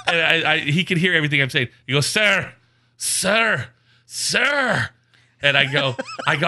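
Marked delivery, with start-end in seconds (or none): 2.1-4.9 high-pitched, insistent calling for attention; drawn-out, higher mock plea to be heard; exasperated scam caller. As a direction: imitate a caller trying to cut in. Scam caller trying to interrupt.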